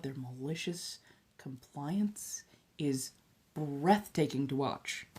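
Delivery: whispered admiration; awed whisper. Real, not acted. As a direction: hush it like something marvellous. Awed aside about compliance.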